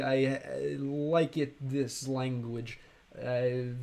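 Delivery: put-on slow, halting learner English with a Russian accent; halting broken English. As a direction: stumble through like an eager beginner, Russian accent. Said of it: "I probably sound like this".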